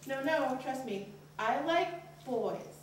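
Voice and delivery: slow, insistent reassurance with a jump up; hasty denial. Acted. Daughter lying to her mom, slow and insistent. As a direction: deny it too firmly.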